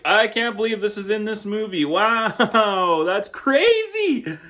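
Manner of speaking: high, laughing mock disbelief; exaggerated wow, rising high on crazy; mock amazement. Reviewer acting out a viewer's reaction, hammed-up amazement.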